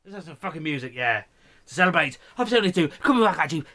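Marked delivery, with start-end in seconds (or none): 1.7-3.6 louder, fast, higher radio hype; mock radio announcer. Hyping the viewers like a radio DJ, fast and loud. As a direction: sell it like a cheesy DJ.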